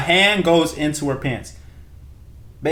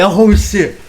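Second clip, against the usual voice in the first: surprised; 7 semitones higher, 5 dB louder than usual. Retold or imitated. Retelling surprise when she kisses.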